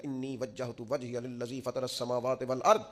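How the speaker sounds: slow Arabic chanting recitation; slow Quran recitation in Arabic; Quranic recitation. Acted. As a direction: intone the verse slowly and devoutly. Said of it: "پڑھنا شروع کیا".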